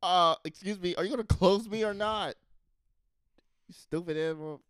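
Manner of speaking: high, loud snooty fridge; indignant appliance voice; talking fridge. Acted. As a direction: fridge scolds the owner. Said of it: "it says".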